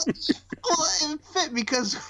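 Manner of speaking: high-pitched, talking through laughter; laughing. Real, not acted. Co-host defends the sing-along, laughing. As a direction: squeeze the words out while laughing. Still laughing after singing.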